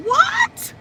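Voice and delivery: very high, incredulous "what"; a squeal of disbelief; shocked what. Retold or imitated. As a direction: react in high-pitched disbelief. Retells shock at pregnancy news.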